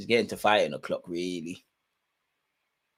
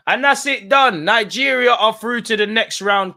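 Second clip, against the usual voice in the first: higher, louder full-time call; excited call; 10 semitones higher, 7 dB louder, slower than usual. Real, not acted. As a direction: announce the result with full hype. Calling the final whistle.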